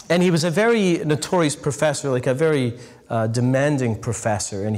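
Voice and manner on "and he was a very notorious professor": ordinary talk, nothing marked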